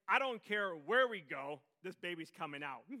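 loud, high hammed-up frantic mother; exasperated Mary. Pregnant Mary telling Joseph the baby is coming, hammed-up loud. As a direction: play the mother at the end of her rope.

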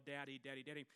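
ordinary talk, nothing marked

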